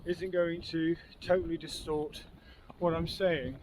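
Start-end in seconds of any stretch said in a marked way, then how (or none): none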